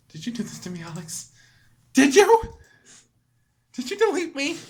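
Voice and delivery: put-on shocked girl discovering betrayal; betrayed Monika. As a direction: go from hushed to shrill disbelief.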